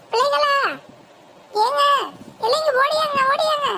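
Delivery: yelling for help. Acted. Wife calling the family to run, a thief inside, urgent yelling. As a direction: raise the alarm to the whole house. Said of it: "எதுக்கு இப்ப கத்திக்கிட்டு இருக்க".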